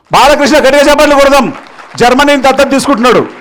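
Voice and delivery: loud, high announcement to the crowd; calling for applause. Real, not acted. Speaker announces a volunteer's pledge to the audience, rallying loudly.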